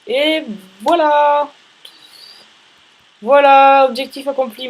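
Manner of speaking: slow, high, loud drawn-out voilà; triumphant. Real, not acted. Gamer celebrating the finished objective, triumphant. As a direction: savor the win out loud.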